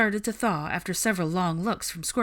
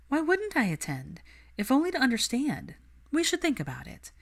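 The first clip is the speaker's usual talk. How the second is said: much higher, amused challenge; teasing Hermione; 4 semitones higher than usual. Acted. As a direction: needle him playfully. Narrator voices Hermione.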